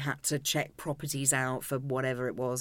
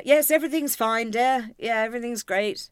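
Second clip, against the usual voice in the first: mock dismissive dad; 6 semitones higher, 5 dB louder than usual. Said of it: "And, uh".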